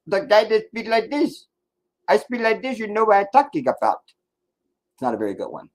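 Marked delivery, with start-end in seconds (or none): 0.1-1.5 put-on Indian accent. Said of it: "That wasn't a very good Indian accent". 2.1-4.0 a weak, higher Indian accent imitation; half-hearted Indian accent, pitched up; lightly done Indian accent, higher voice; bad Indian accent. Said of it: "That wasn't a very good Indian accent".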